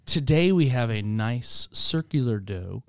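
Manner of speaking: slow, grand menu delivery, drawn out; fancy food description. Pretend. Pastor hamming up dinner for his kids, slow and grand. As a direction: describe pizza like a gourmet chef, slowly. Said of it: "if I just said".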